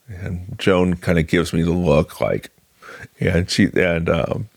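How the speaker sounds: ordinary delivery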